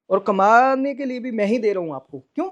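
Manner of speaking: loud, high, indignant; furious complaint about supporting her; angry outburst. Real, not acted. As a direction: shout the indignant question. Angry climax about money.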